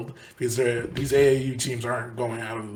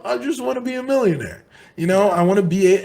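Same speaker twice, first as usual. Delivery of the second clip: lightly voiced wannabe millionaire, higher pitched; mock player's voice; 6 semitones higher, 6 dB louder, faster than usual. Podcaster voicing money-minded players, lightly mimicked higher voice. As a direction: pitch it up a little as an eager player's quote.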